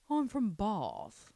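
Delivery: slow, lightly put-on English accent saying "Bath" with a broad vowel; a hint of a posh Bath English voice; mock English accent. Guessing how someone from Bath says it, lightly put-on English accent. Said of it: "I don't know how the Bathians speak".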